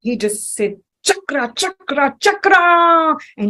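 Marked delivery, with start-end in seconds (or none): 1.0-3.2 building to a loud, high furious call; enraged Lord calling his disc; angry summons. Retold or imitated. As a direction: call the weapon in rising rage. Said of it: "he was mad".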